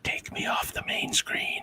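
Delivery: slow, soft whisper; whispered aside. Acted. Asking to leave the main screen, whispered. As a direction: whisper it as a hushed plea. Embarrassed aside on a live stream.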